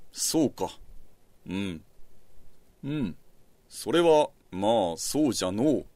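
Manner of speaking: lightly voiced evasive old emperor, quiet; noncommittal old man. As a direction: mumble vaguely, a hint of creaky old man. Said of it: "のらりくらりと".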